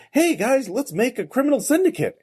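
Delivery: high, chirpy mock enthusiasm; eager pitch. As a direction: pitch it high like a gleeful group proposal. Mimics eager players forming group.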